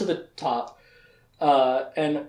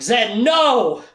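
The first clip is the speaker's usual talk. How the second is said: high, loud cry to stop; mock shout; 6 semitones higher, 6 dB louder than usual. Richard stops Zed attacking Shota, urgent mock shout. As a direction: imitate a hero's urgent yell.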